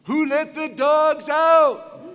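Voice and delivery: high, slow rallying shout; loud and proud. Real, not acted. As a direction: shout it out slowly to fire up the crowd. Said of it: "I wanna hear it loud and proud".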